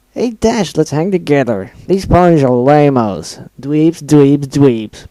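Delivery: loud, taunting mock impression; mimicking Gilda. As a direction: sneer it like a mean bully. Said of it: "Pinkie mimicked".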